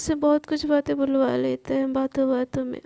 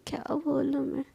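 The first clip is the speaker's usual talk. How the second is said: softer, breathy aside; teasing whisper; 6 dB quieter than usual. Acted. Sana whispers teasingly to Palak about her dream girl. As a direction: whisper it like a cheeky secret.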